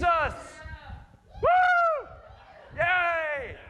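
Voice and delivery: shrill high whoop; mock cheering. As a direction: cheer like a stadium crowd. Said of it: "we can do this in church".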